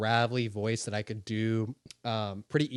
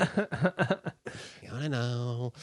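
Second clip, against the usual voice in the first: Joker impression; much slower than usual. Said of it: "You're actually quite good at impressions".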